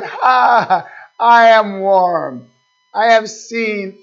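loud, slow, gloating boast; gloating idol-maker. Acted. Preacher voicing the proud man's slow gloating boast. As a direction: savour each word of the brag. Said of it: "He said".

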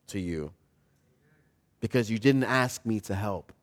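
soft, low, gentle correction; quiet fatherly reassurance with long pauses; patient father. Acted. Father offering his son help, tender and unhurried. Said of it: "And the father said".

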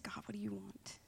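very soft, whispered plea to God; hushed prayer. Retold or imitated. Retold private prayer at the altar.

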